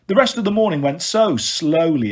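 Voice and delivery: plain reading voice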